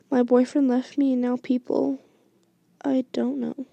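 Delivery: ordinary delivery, nothing marked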